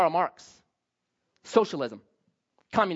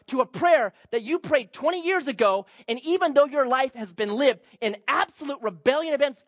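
ordinary talk

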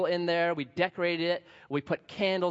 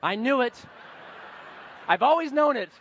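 higher, louder, gloating heckler voice; 7 semitones higher, 3 dB louder than usual. Retold or imitated. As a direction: play a smug heckler catching him out. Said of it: "The rednecks in there are like".

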